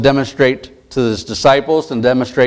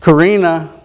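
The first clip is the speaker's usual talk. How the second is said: higher called name; calling out; 5 semitones higher, 3 dB louder than usual. Acted. Jesus calling Evelyn to come forth, calling out. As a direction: summon her by name.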